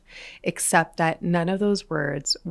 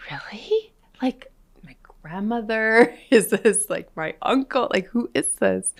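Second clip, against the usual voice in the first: eager surprise; 5 semitones higher, 3 dB louder than usual. Retold or imitated. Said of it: "And I'm like".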